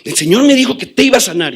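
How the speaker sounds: high, loud, fast and angry; an angry father insisting on the healing; angry insistence. Retold or imitated. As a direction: fire "El Señor me dijo que te iba a sanar" at him in anger. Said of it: "lo hice enojado".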